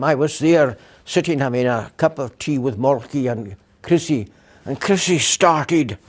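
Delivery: normal talking voice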